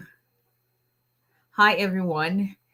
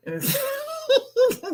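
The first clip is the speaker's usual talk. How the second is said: high cracking laugh. Real, not acted. Laughing at a comment.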